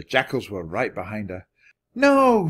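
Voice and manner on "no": very high, loud, held scream; piercing storybook cry. A narrator's reading. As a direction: scream it in horror. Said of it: "screamed Annie".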